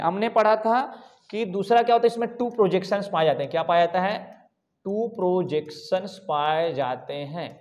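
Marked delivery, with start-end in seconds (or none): 4.8-7.5 slow, drawn-out, softer and lower repeat; slow dictation. Shown as a demonstration. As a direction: spell it out word by word for note-takers. Teacher repeats it slowly for class.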